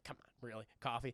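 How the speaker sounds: soft, low skeptical mutter; under-breath doubt; quiet disbelief. Retold or imitated. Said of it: "He's like".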